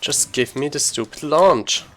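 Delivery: usual speaking voice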